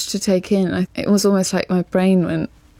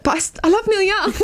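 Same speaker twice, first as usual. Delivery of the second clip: high, fast gushing outburst; mock fan protest; 11 semitones higher, faster than usual. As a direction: blurt it out high with a laugh. Acting out her own protest.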